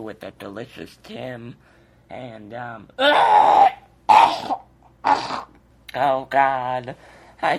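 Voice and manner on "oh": coughing fit. Real, not acted.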